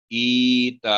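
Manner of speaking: ईता held long and melodic; slow recitation. Shown as a demonstration. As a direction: recite it slowly. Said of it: "teacher models recitation".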